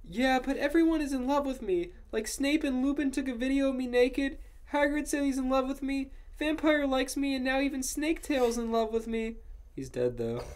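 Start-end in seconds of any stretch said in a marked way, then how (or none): 0.0-9.4 very high, whiny character voice; mock angry shouting. A narrator's reading. Ebony listing her admirers to Draco, high angry whine. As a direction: protest in a high-pitched whine. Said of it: "I shouted angrily".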